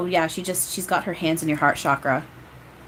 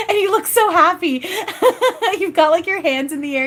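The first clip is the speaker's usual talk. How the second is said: giggling through the words, higher and louder; laughing; 12 semitones higher, 6 dB louder than usual. Real, not acted. Medium telling the sitter of the happy vision, laughing. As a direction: laugh along with the happy vision as you describe it.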